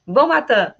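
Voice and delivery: bon matin said the French way, nasal bon; Canadian greeting. Shown as a demonstration. Teacher showing Canadian bon matin. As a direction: model bon matin clearly. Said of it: "no Canadá é bon matin".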